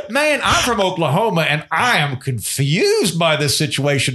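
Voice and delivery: slow, exaggerated Okie drawl of a bewildered man; Okie accent. Shown as a demonstration. Comedian demos his Okie stage voice, slow Oklahoma accent. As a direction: lay the Oklahoma accent on thick. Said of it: "I'm Andrew Heaton".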